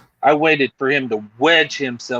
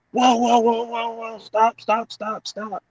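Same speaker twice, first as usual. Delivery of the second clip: imitating a panicked agent, pitched much higher; high, urgent mock "whoa, stop"; frantic agent; 9 semitones higher, 3 dB quieter than usual. Agent frantically stopping the car.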